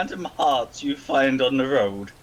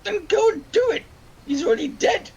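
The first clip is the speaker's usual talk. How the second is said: character voice. Acted. Character retort.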